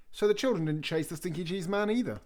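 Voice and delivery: ordinary delivery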